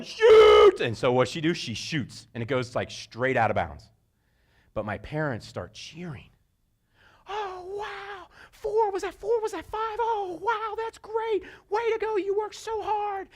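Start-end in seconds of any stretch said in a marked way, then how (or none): 0.0-0.8 high, loud crowd yell; sideline yelling. Retold or imitated. Whole sideline yelling at the girl to shoot. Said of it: "everybody in the sideline's going". 7.3-13.3 high, gushing, excited praise; excited parents cheering. Retold or imitated. Parents on the sideline cheering the girls' passes, high excited.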